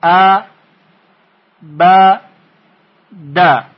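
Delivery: ا، ب، د as separate short "a", "ba", "da"; split into syllables. Shown as a demonstration. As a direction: sound out a... ba... da, pausing after each.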